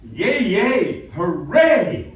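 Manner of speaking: loud, high-pitched jubilant cheer; big cheer. Shown as a demonstration. Coach showing student the expletive reading, big loud cheer. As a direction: cheer it out at full voice. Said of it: "there's a way to interpret that".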